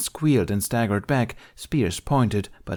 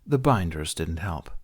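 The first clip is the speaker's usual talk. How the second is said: ordinary delivery, nothing marked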